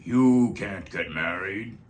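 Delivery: the stink bug forbidding the bunnies, slow and scary. A narrator's reading. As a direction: lay down the law, slow and threatening. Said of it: "said a scary voice".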